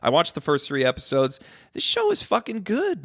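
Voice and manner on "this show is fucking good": high, thrilled burst of praise; excited praise. Real, not acted. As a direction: gush about it, pitch jumping up. Raving about a show.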